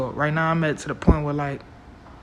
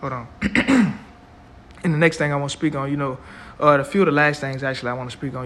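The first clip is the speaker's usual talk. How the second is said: normal talking voice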